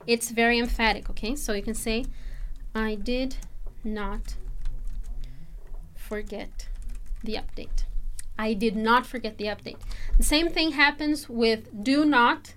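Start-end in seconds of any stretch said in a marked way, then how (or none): 2.7-7.8 I did not forget the update, each word spaced out, not stressed; careful teacher demo stressing not; slow emphatic not. Shown as a demonstration. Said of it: "if I say did not instead of didn't, it's very emphatic". 8.4-9.8 not stressed, said in full; emphatic not. Shown as a demonstration. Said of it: "if I say did not instead of didn't, it's very emphatic".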